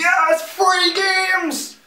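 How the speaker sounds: very high, loud and slow cheer; drawn-out excited squeal. Acted. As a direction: stretch it out in giddy delight. Mock cheer at news item.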